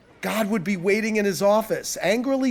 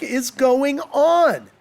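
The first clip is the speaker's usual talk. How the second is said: very slow, raised, louder question; baffled, pitched up and drawn out; bewildered wondering; 6 semitones higher, 5 dB louder, much slower than usual. A narrator's reading. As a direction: drag it out in disbelief. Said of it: "he wondered".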